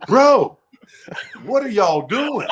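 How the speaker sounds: high, slow, laughing disbelief; baffled. Retold or imitated. Confused newcomer asking warm-up singers what they're doing. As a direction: ask it like you can't believe your ears. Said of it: "I'm like".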